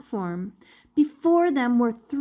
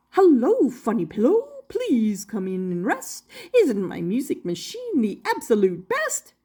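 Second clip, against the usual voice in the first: lightly voiced jolly inventor. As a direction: greet the guests like a cheery showman. Doctor Marvel's rhyming voice.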